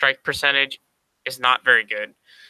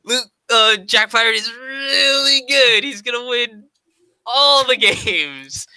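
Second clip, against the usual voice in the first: mock hype, high and drawn out; joking; 9 semitones higher than usual. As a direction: overblown joking praise.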